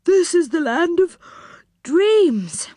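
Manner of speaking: high, yawning sleepy voice; drowsy, high-pitched wonder. A narrator's reading. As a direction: speak through a big yawn. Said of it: "said Moonface sleepily".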